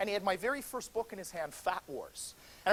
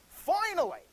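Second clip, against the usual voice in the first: excited; 10 semitones higher, 5 dB louder, slower than usual. Retold or imitated. Inner thought, long-awaited praise.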